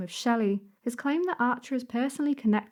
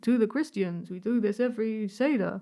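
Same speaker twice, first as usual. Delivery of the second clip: put-on Yiddish accent.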